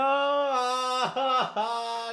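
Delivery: a high burst of disbelieving laughter; shocked high laugh. Real, not acted. Laughing in high disbelief at her message. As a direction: laugh it off in horror.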